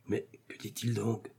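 soft, muttered aside; muttering. A narrator's reading. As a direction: grumble it under your breath. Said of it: "marmotta Porthos".

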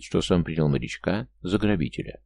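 plain reading voice

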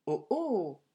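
plain reading voice

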